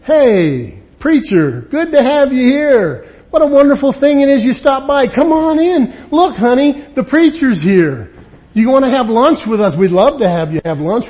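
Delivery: greeting the preacher at the door, overly sweet; sudden sugary welcome. Pretend. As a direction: flip instantly to sweet company manners. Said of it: "all of a sudden you go".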